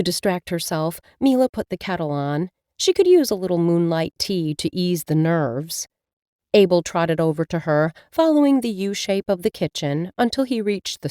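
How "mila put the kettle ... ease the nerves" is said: plain reading voice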